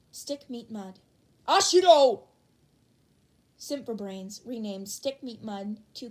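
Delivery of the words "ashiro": huge loud indignant shout; outraged yell. Acted. Ida yelling at the prankster, outraged shout.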